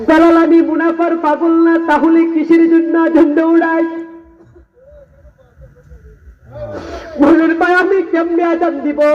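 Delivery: high, impassioned sermon voice; fervent waz preacher pleading; preaching at full force. Acted. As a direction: plead as Abu Bakr from the pulpit.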